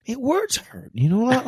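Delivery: high-pitched, playfully hurt voice; mock wounded. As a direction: squeak it out as if hurt by the teasing. Mock hurt at co-host's teasing.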